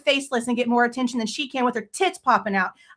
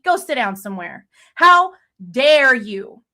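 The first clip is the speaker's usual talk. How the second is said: much louder and slow, each word hammered; furious; 6 dB louder than usual. Real, not acted. Vlogger raging at Two-Faced Tony, loud and slow. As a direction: drag it out loud in outrage.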